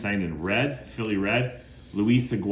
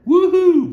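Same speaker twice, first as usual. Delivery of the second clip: much higher, much louder whoop; excited woohoo; 14 dB louder than usual. Real, not acted. Collector cheering a blue Sharpie autograph, excited high loud whoop. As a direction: cheer like you hit the jackpot. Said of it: "gonna get a little excited if I get a blue Sharpie".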